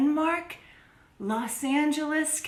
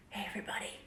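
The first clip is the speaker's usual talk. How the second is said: quiet whispered hello; 6 semitones lower, 15 dB quieter, much faster than usual. Real, not acted. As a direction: breathe the greeting softly. Hushed greeting.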